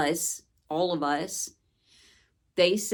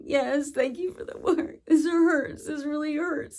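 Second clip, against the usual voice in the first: high, tearful, breaking voice; crying while speaking; 8 semitones higher than usual. Retold or imitated. Telling the beings who hurt her, crying high. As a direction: sob the words out, pitched up. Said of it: "I would just be crying and saying".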